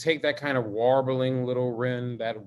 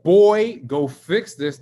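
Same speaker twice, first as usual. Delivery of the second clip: serious father; 2 semitones higher, 7 dB louder, much slower than usual. Retold or imitated. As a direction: imitate a dad issuing a no-nonsense command. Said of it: "So when he said".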